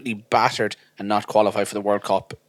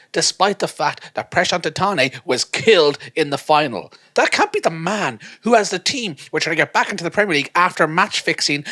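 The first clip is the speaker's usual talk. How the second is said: awed fan; 6 semitones higher than usual. Retold or imitated. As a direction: gush with growing amazement. Starstruck fan inner monologue.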